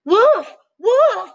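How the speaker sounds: a cat trying to scare the birds off; crying out. Acted. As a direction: yell the false alarm. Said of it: "he cried".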